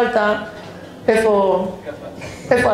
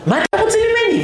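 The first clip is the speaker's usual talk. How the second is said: very high-pitched, hammed-up exasperation; mock indignant protest. Flight attendant to passenger, high mock-indignant protest. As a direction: push it up high, as in a squabble.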